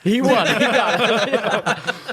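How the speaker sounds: high, loud laughing concession, dragged out slowly. Real, not acted. Medic laughing at the patient's parting insult. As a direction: laugh helplessly while admitting defeat.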